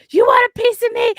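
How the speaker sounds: very high, loud Bronx shout; Bronx Italian shout. Retold or imitated. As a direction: yell it like a Bronx Italian mom ready to fight. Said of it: "the Bronx Italian came out in full force".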